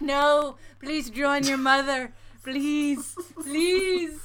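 loud, imploring mock plea; hammed-up desperate begging; mock pleading. Acted plea to Persephone.

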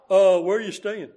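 hesitant, embarrassed mumble; sheepish disciple. Retold or imitated. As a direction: play it like a fumbling disciple. Repeats the disciples' odd answer.

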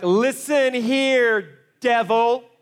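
loud, high and very slow, stern; commanding rebuke. Retold or imitated. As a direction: rebuke the devil with full authority. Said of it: "He is mad at something".

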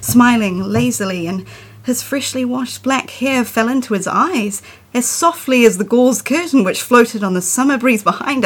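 measured, earnest poem recital; poetry reading. Acted. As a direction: read it like a confession poem. Reads her poem aloud.

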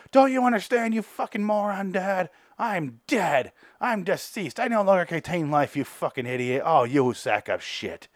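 high-pitched, hammed-up teenage tirade; scolding. Dead daughter to her clueless dad, exaggerated scolding. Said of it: "she's just scolding him".

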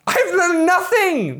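high, slow, emphatic complaint; exasperated outburst. Real, not acted. Viewer answering whether the movie taught anything, exasperated.

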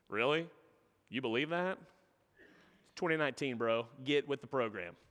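low, scoffing put-down; a mocking bro, pitched low; mocking friends. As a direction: sneer at the old-fashioned view. Friends mocking his views.

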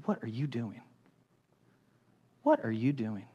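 low, quiet disbelief as a Pharisee; quiet, appalled questioning; hushed reproach. Retold or imitated. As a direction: voice their reproach softly, under your breath. Pharisees' shocked reproach, voiced low.

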